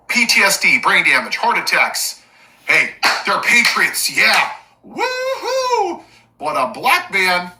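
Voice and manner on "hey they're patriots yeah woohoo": sarcastic cheer. Acted. Sarcastic mock cheer for rioters.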